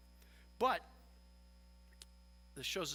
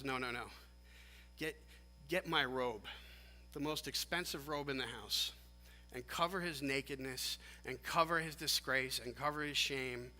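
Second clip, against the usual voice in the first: lower, hushed reassurance, softening to the end; low, soothing voice that settles softly; tender forgiving father; 4 semitones lower, 4 dB quieter than usual. Acted. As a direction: gently take charge as a welcoming father.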